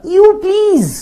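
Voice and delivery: high, loud, put-on girl's voice; mock girly dismissal. Date waving off talk of kids, mock girly and high. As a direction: brush him off like a fussy girl.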